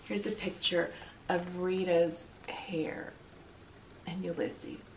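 soft, slow whispered aside. A narrator's reading. Reader whispers an aside to the listeners. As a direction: lean in and whisper it.

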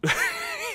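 high, breathy laugh; emotional laugh. Real, not acted. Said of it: "I'm being incredibly emotional".

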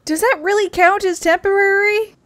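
loud, high disbelieving retort; incredulous. Acted. Companion scoffs at Mona's temporary plan, incredulous.

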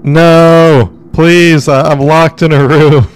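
loud desperate pleading; pleading shout. Real, not acted. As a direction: yell for help through a door. Locked in, calling for help.

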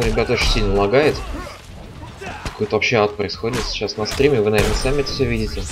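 ordinary delivery

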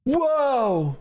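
real startled yelp. Real, not acted. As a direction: jump at the scare. Startled by jump scare in game.

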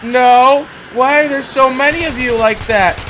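loud, high dismayed outburst; alarmed protest. Real, not acted. Player facing so many enemies, alarmed protest. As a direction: object loudly in alarm.

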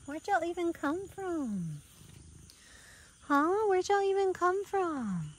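high, slow cooing question to kittens. Real, not acted. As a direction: coo slowly and high at the wet kittens. Baby talk to kittens.

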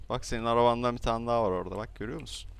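usual speaking voice

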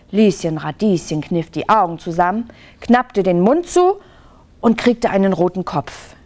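usual speaking voice

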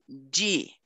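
"Di" said "jee"; D softened to J. Shown as a demonstration. Teacher repeats after student, "Di" as "jee". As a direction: say "Di" like the start of jeep. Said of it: "vai ganhar a fonética".

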